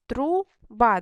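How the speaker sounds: «Труба» по складах, із паузою: тру — ба; розділи «Труба» на два окремі склади; поділ на склади. Shown as a demonstration. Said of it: "два склади". («Труба» розмірено, склад за складом)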